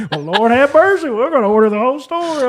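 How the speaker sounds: high, loud mock old-lady outrage; exasperated grandparent. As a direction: throw up your hands like a flustered granny. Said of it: "They're like".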